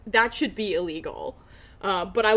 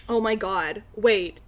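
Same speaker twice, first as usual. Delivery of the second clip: ordinary talk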